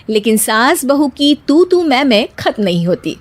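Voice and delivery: usual speaking voice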